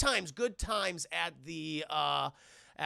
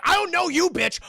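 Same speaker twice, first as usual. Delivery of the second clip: high, loud mock outburst; angry dog imitation; 11 semitones higher, 12 dB louder than usual. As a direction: yell it as an aggressive talking dog. Voicing hostile dog about to attack.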